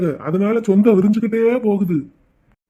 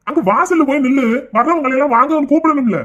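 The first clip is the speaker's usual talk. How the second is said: raised voice; 5 semitones higher, 3 dB louder than usual. Acted. Father sends daughter to door.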